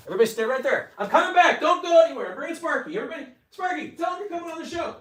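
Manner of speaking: high-pitched call while walking off; high, excited calling out. Real, not acted. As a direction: holler to everyone as you leave. Calls out while leaving to fetch the dog.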